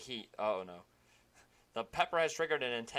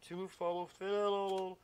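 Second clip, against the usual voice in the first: high, slowed ghostly moan; 7 semitones higher, 5 dB louder, much slower than usual. Acted. Ghost urging the player to fulfill destiny, high eerie moan. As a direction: float the words out eerily, high up. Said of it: "I can do a better ghost voice".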